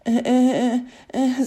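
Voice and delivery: high, halting terrified stutter; elf's jaw trembles as he stammers; stammering in fear. A narrator's reading. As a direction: stammer with chattering teeth. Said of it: "szczęki tak drżały ze strachu".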